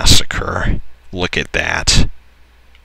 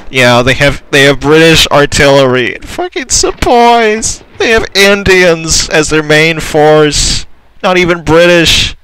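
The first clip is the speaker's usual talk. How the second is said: much higher and louder, worked-up rant; outraged disbelief; 9 semitones higher, 4 dB louder than usual. Real, not acted. Gamer ranting at the enemy army, high and loud.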